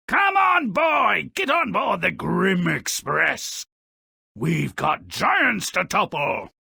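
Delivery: loud, high eager call to adventure; rallying shout. Acted. Jack urging himself up the beanstalk, loud eager shout. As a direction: shout it like boarding a train.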